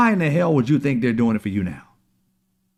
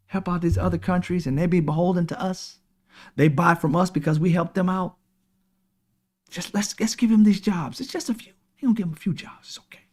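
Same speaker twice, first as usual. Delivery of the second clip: higher and much softer, coaxing; mock sweet-talking billionaire, higher and hushed; mock soothing billionaire; 5 semitones higher, 4 dB quieter than usual. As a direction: coax the public like a smooth con man. Mocking billionaires' soothing pitch.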